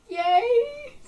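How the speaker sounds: high, loud happy squeal of joy; excited cheer. Real, not acted. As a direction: let the joy burst out in a big cheer. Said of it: "I'm so excited to talk about this".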